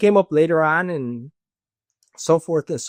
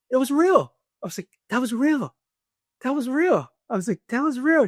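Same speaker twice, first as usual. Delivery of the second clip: urgent realization; 8 semitones higher than usual. Retold or imitated. Realizing near-death experience was real.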